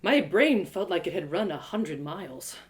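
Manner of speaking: usual speaking voice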